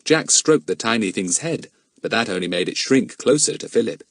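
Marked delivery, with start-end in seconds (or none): none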